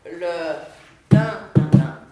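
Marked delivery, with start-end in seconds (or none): 1.1-2.0 loud, percussive "tin, tin" like a metronome; hammered imitation of a ticking metronome; metronome beat. Shown as a demonstration. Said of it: "ce que le métronome est". (tin, tin martelé comme un métronome)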